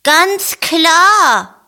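high, lively little bunny voice; Ferdinand voice. A narrator's reading. To his friends about the ear warmer, high and pleased. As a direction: chirp it out brightly. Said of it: "sagt er".

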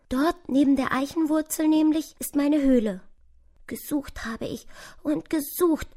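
high, plaintive little mouse voice; kleine, verirrte Maus. Acted. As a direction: play a tired, freezing mouse with a small high voice. (hohes, jammerndes Mäusestimmchen)

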